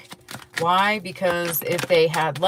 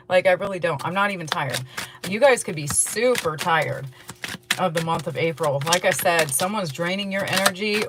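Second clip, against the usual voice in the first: ordinary talk, nothing marked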